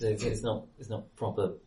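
normal talking voice

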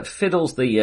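ordinary talk, nothing marked

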